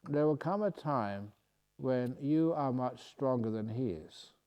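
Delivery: ordinary talk, nothing marked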